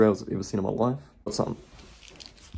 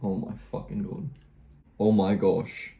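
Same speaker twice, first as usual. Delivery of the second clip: usual speaking voice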